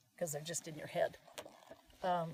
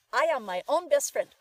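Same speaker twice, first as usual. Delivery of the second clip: loud, higher, emphatic recital; kids reciting; 6 semitones higher, 11 dB louder than usual. Retold or imitated. Coach imitates kids earning a quarter, loudly reciting. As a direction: chant it out like an eager child. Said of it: "the kids would say".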